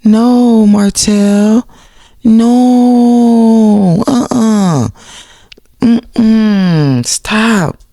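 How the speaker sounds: high, wailing protest; exaggerated refusal. Telling Martell no, exaggerated wail. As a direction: wail it out, long held high notes.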